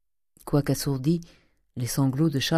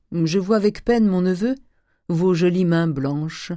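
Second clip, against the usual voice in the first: higher, louder, drawn-out sneer; mocking uncle; 4 semitones higher, 5 dB louder than usual. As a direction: tease with feigned regret, stretching the last words. Oncle moque les mains du neveu.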